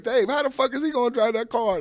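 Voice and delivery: very high, loud mock mother voice; high-pitched mom's outburst; imitated incredulous mom. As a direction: act out a friend's mom hollering in disbelief. Pun's mom scolds in disbelief.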